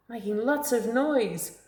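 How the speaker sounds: ordinary delivery, nothing marked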